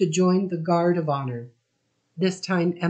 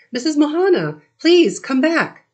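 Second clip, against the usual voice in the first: raised, higher call across the room; urgent, higher, louder call to the wife; 10 semitones higher, 7 dB louder than usual. A narrator's reading. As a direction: call her back from the kitchen. Calling the wife back from kitchen.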